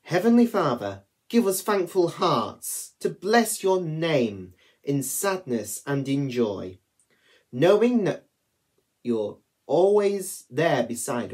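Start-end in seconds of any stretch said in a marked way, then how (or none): none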